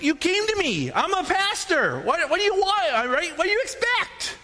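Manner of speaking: high-pitched, incredulous protest; mock exasperation. Pastor to couple objecting to scripture, exasperated.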